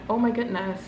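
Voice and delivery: ordinary talk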